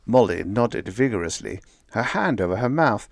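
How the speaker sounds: ordinary delivery, nothing marked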